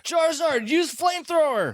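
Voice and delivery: very high shouted attack call; battle command. Acted. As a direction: yell the command like an anime hero.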